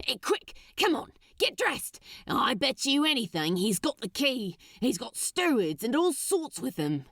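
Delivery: hustle your lover out of bed; alarmed Crowley. Acted. Crowley urging lover to dress.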